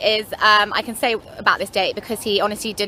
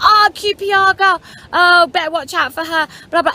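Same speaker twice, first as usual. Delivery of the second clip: put-on high, loud teasing football-lad voice with a Scottish accent; mock Scottish lad; 7 semitones higher, 5 dB louder than usual. Celtic fans teasing the QPR girl, hammed-up Scottish accent. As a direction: tease her like a rowdy Celtic fan. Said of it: "quite thick accents".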